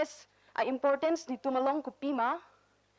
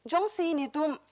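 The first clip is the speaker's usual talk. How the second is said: ordinary talk, nothing marked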